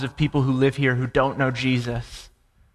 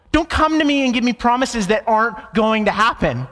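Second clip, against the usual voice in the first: high-pitched, acted disbelief building into protest; incredulous Sarah; 9 semitones higher than usual. Sarah hearing the promise too late, incredulous protest. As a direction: imitate an exasperated, disbelieving complaint. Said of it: "she sits there and she goes".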